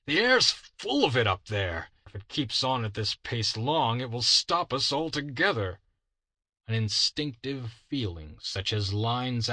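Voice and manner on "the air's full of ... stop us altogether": raised voice. Acted. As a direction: call it out over the wind. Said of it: "cried the leader".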